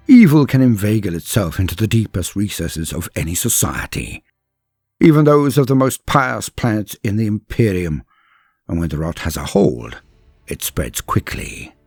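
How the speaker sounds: plain reading voice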